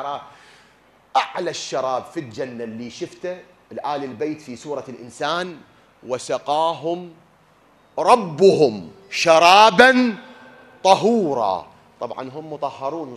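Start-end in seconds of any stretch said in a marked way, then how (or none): 6.0-11.7 slow, much louder chanted recital; Quranic recitation. Shown as a demonstration. Preacher recites the verse on the pure drink, slow loud chant. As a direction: stretch each word out like tajwid recitation.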